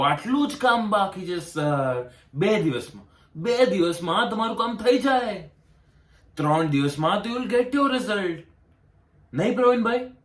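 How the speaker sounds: high-pitched mock reassurance; mimicked official's promises. Applicant imitating the officer's promise of two days, mocking.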